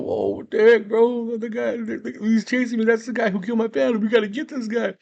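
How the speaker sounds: high frantic yelp of a scared man; panicked quote. Retold or imitated. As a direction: blurt it out like you're being chased. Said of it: "tells Anton".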